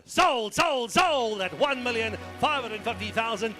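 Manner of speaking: loud, high triumphant shout; shouting sold. Real, not acted. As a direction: bang it out: sold. Hammer falls, shouting sold.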